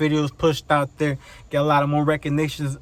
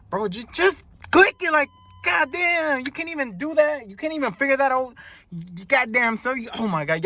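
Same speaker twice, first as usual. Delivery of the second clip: high-pitched, worked-up disbelief, tripping over words; stuttering exasperation; 8 semitones higher than usual. Retold or imitated. Driver to people who can't find the light button, stuttering and exasperated. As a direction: scold them in a high, flustered voice. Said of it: "y'all have me stuttering right here".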